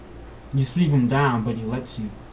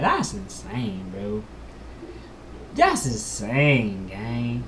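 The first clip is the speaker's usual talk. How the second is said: slow disbelief; 4 dB quieter, much slower than usual. Real, not acted. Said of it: "player reacting to the roll".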